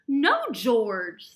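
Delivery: loud, mildly angry scolding; frustrated mother. A narrator's reading. George's mother fed up with her puppy, loud frustrated scolding. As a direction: tell the pup off, loud and exasperated. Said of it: "getting a little angry".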